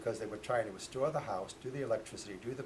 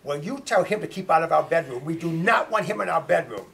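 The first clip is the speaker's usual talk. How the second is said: loud, high, outraged demand; indignant order; 6 semitones higher, 16 dB louder than usual. Retold or imitated. Father orders daughter to keep the ghost out, loud and indignant. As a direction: lay down the law at full volume.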